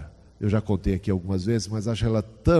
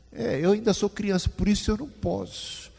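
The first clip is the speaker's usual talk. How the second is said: put-on childlike voice, much higher; a light hint of a little boy; child's reasoning; 9 semitones higher than usual. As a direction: play the boy reasoning it out, innocent.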